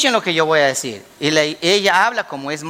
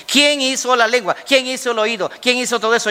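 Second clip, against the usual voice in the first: raised pitch, questions in quick succession; insistent divine challenge; 5 semitones higher than usual. Acted. God challenges Moses about who made everything, insistent. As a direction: press each question home, pitched high.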